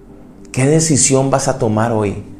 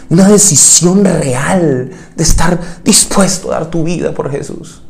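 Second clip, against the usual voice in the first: loud, high fervent preaching; impassioned preaching; 5 semitones higher, 4 dB louder than usual. Acted. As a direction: drive it home from the pulpit. Preaching at full force. (predicación con toda la fuerza)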